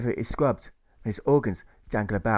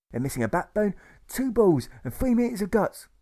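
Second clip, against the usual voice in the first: mocking patient; 10 semitones higher, 4 dB louder than usual. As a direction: jeer it, pitch soaring at the end. Patient mocks pacifists.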